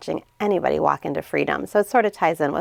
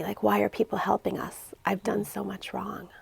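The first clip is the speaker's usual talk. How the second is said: ashamed whisper; 2 semitones higher, 9 dB quieter than usual. Retold or imitated. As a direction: confess it under your breath. Girl confessing she feels unworthy.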